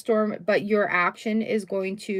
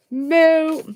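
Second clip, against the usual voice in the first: cow moo; 6 semitones higher, 7 dB louder than usual. Pretend. Interrupting cow again.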